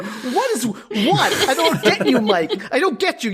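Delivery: high, incredulous, half-laughing protest; baffled rant breaking into laughter; exasperated bafflement. Acted. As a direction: throw up your hands in disbelief.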